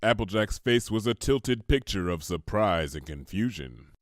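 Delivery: ordinary delivery, nothing marked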